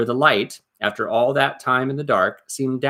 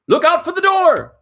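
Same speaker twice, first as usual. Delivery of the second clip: much higher and louder commanding roar; goblin captain bellowing; 7 dB louder than usual. Acted. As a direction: bellow the order at the guards. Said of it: "bellowed the captain".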